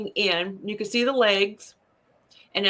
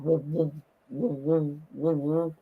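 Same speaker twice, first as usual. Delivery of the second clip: low, soft repeated woo; imitating a throbbing sound in the ears; imitated ear vibration; 6 semitones lower, 5 dB quieter than usual. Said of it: "My ears started going".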